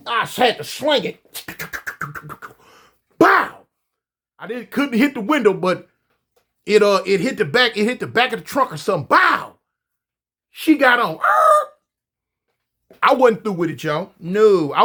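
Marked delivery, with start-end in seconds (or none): 3.2-3.7 shouted high bang noise; loud bang imitation. Pipe hitting the truck. 9.1-9.6 shouted high bang noise; loud bang imitation. Pipe hitting the truck. 11.2-11.7 very high, loud screech noise; tire screech imitation. Tires screeching away.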